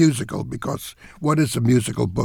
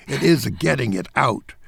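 usual speaking voice

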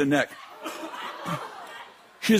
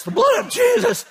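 very high comic shriek; frantic high-pitched mock scream; panicked cry; 4 dB louder than usual. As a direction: shriek it like a desperate deliverance plea. Panicked cry while fighting demon.

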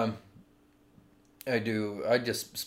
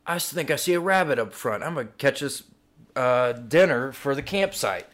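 put-on eager, higher-pitched teenage son; lightly acted higher kid voice; voicing the son; 3 semitones higher than usual. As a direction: call out to the family mid-hike. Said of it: "and the son says".

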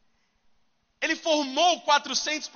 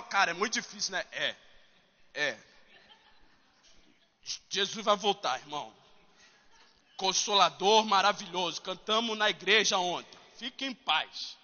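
self-pitying friends; 6 semitones lower, 4 dB quieter than usual. Retold or imitated.

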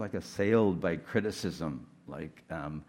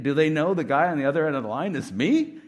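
louder, higher, amused disbelief; young volunteer doubting himself, louder and higher in disbelief; incredulous; 7 semitones higher, 8 dB louder than usual. Retold or imitated. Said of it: "I'm thinking".

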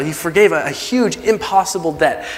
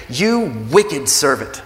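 slow, weighty condemnation; stern rebuke; much slower than usual. Acted. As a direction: pronounce judgment word by word. Master rebukes the servant.

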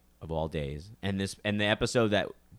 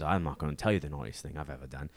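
imitated British actor, lower than the kid; British accent; 5 semitones lower, faster than usual. Actor refusing a kid caller's question, imitated British accent. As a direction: answer in a put-on British accent, pitched down. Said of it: "it's his fucking British accent".

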